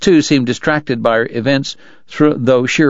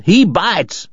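called out; 7 semitones higher than usual. Acted. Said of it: "Wyatt called".

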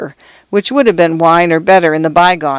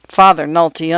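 plain reading voice

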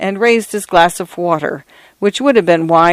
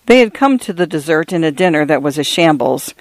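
usual speaking voice

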